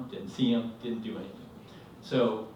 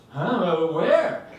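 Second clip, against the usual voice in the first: loud, higher confused blurt; just woken and casting about, louder and higher; groggy bewilderment; 6 semitones higher, 9 dB louder than usual. Retold or imitated. Groggy husband asking his screaming wife, bewildered. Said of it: "I'm very groggy".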